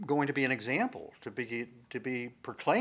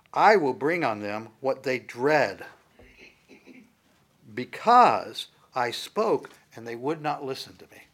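booming voice of God; 8 dB louder than usual. Acted. Quoting God's words.

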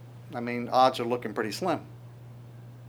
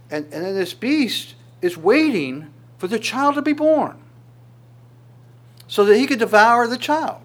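rising, louder urgent preaching; building urgency; 8 semitones higher, 6 dB louder than usual. Real, not acted. Preacher stirring the congregation to save the child, urgent and loud. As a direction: build it up higher and louder to the rescue.

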